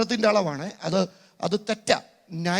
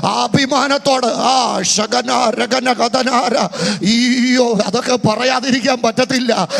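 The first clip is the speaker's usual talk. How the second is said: loud, driving sermon delivery; full-force preaching; 2 semitones higher, 6 dB louder than usual. Acted. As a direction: preach it out to the hall. Preaching builds up. (ആവേശത്തോടെ ഉറക്കെ പ്രസംഗിക്കുന്നു)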